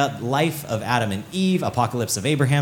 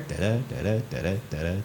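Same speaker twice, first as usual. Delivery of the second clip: "Da-da, da-da" with the second syllable stressed, softer and lower; iambic rhythm; 5 semitones lower, 7 dB quieter than usual. Shown as a demonstration. Teacher demonstrates iambic pentameter to the class, rhythmic and soft. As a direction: tap out iambic meter on "Da-da". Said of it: "like iambic pentameter".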